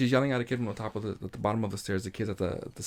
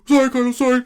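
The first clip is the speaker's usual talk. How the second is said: mock kid apology; 10 semitones higher, 7 dB louder, much faster than usual.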